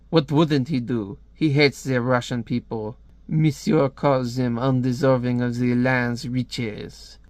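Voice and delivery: put-on French accent, measured. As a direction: explain the villain in a mock French accent. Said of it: "I hate doing a French accent".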